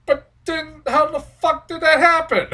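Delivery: high, loud disbelieving protest; hammed-up baffled question; incredulous outburst. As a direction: demand an explanation in disbelief. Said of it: "So I'm like".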